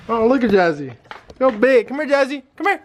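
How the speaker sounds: high coaxing voice to a puppy; sweet high call to the dog; puppy talk. Real, not acted. Calling the puppy over.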